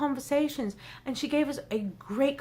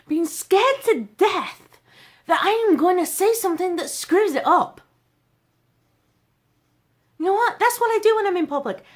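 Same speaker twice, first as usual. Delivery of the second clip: loud, higher-pitched and emphatic; full personality; 5 semitones higher, 6 dB louder than usual. Real, not acted. Speaker shows the audience her unguarded self with a best friend, loud and animated. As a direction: let it all out at full volume. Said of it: "you're seeing my full personality now".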